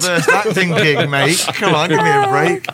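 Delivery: high-pitched mock plea; retold pleading. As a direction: plead for mercy, half-joking. Pleads with director after accent request.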